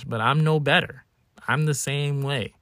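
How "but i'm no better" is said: usual speaking voice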